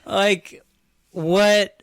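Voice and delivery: high, loud disbelief; baffled. Real, not acted. Baffled by the film's ending.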